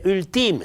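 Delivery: ultim con l'accento sull'ultima sillaba; pronuncia sbagliata. Shown as a demonstration. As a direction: leggi ultim come parola tronca. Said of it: "sarebbe portato all'ese". (pronuncia errata di ultim)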